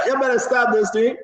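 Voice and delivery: ordinary delivery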